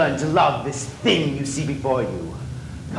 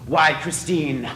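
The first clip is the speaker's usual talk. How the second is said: usual speaking voice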